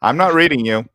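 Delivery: loud, high, mock radio call; fake bad connection. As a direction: pretend the line is dropping. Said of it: "you're faking".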